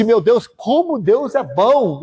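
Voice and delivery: slow, lifted exclamation of wonder; retold grateful awe. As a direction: marvel at it, drawing the words out high. Retells his marvelling at God's goodness.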